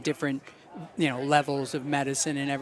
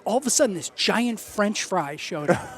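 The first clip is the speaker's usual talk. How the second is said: excited retelling; 4 semitones higher than usual. Retold or imitated. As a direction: imitate someone amazed by what they saw. Retelling woman's amazed trip report.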